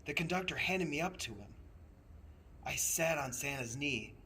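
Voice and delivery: usual speaking voice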